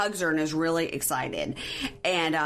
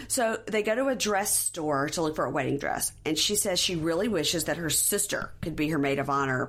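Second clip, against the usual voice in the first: usual speaking voice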